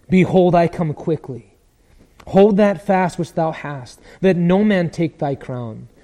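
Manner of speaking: loud, slow scripture declaration; Jesus' urgent proclamation. Acted. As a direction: proclaim it with weight, stretching the words. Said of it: "What does he say?".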